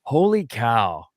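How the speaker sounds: slow, drawn-out amazement; mock stunned disbelief; amazed. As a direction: let it sink in, awed. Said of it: "I was like".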